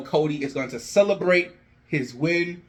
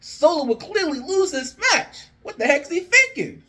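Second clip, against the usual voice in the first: high, laughing disbelief; a hammed-up bewildered fan; mock baffled squeal; 13 semitones higher than usual. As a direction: squeak out the confusion, half laughing. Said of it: "He's like".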